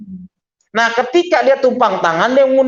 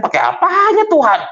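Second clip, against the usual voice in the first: startled retort; 9 semitones higher than usual. Retold or imitated. His startled retort to her.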